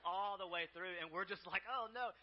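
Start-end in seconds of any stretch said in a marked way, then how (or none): 1.7-2.1 high, loud dismay; watching a disaster unfold; horrified oh no. Retold or imitated. Staff gasp as the girl crushes the crabs, loud high dismay.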